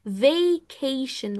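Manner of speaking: vacation stretched very slowly, every syllable long; drawn-out vacation. Shown as a demonstration. Teacher modelling vacation for students to repeat. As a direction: drag out vacation as much as you can. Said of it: "ومدوها كده as much as you can".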